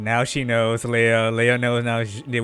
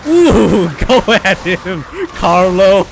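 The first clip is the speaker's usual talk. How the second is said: excited cheering; 6 semitones higher, 8 dB louder, slower than usual. Real, not acted. Cheering the cutscene.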